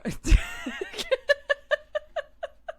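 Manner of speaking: real laugh, high and loud; giggling at the bad accent; high laughter. Real, not acted. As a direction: laugh at yourself. Laughing after own bit.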